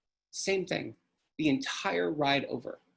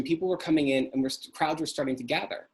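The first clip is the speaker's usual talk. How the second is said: usual speaking voice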